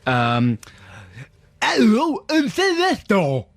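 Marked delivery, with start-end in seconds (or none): 1.6-3.4 high, slow put-on Leicester voice; camp, over-the-top invented Leicester accent; made-up Leicester accent. Shown as a demonstration. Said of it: "That's too much, though".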